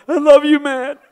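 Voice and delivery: high, loud hammed-up sob; over-the-top crying declaration; mock sobbing. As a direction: blubber it out like a movie scene. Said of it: "I got tears coming down my face".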